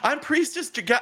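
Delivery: very high, chirpy greeter voice; high-pitched, over-keen hostess; excited priestess. Acted. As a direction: play an eager priestess welcoming guests. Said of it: "She says".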